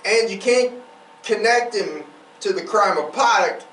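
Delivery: usual speaking voice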